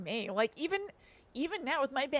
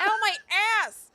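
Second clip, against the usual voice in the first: high, loud playful wail; hammed-up ouch; mock pained yell; 10 semitones higher, 8 dB louder than usual. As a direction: cry out in pretend pain. Echoes the fall as a joke.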